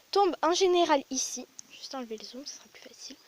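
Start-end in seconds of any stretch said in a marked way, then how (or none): 1.7-3.2 unvoiced aside whisper. Real, not acted. Whispering an aside while fixing the zoom. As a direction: whisper it to yourself.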